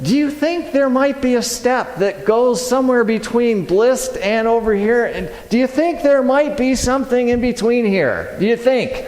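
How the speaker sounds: high, incredulous rhetorical questioning; exasperated comic sarcasm; sarcastic self-mockery. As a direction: needle yourself for missing the obvious. Mocks himself for missing middle step.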